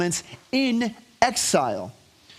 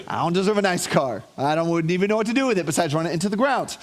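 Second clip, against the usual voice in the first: normal talking voice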